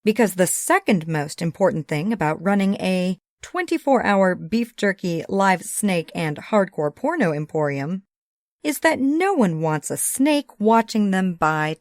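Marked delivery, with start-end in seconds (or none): none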